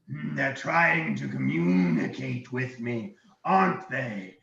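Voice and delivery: slow, suspicious dragon. Acted. Dragon talking to himself about intruders, dragon voice. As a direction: muse slowly as a wary dragon. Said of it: "he looks around and he goes".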